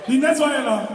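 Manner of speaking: ordinary delivery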